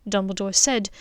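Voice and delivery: plain reading voice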